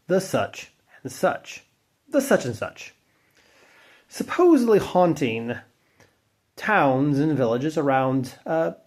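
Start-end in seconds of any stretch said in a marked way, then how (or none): none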